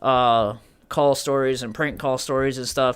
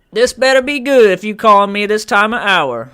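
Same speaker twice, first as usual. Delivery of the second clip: higher, louder put-on voice, fed up; impersonated woman caller; 7 semitones higher, 8 dB louder than usual. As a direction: mimic an indignant woman answering a late call. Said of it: "I try to do an impersonation".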